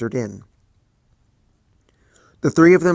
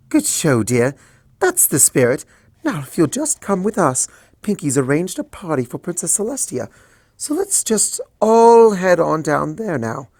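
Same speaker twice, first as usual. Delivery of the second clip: soothing; 6 semitones higher than usual. Acted. Rarity soothing Twilight.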